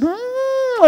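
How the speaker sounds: high warm "mm" hum; a long loving hum mid-hug; affectionate hum. Retold or imitated. Hugging the stepmother at a wedding, affectionate hum.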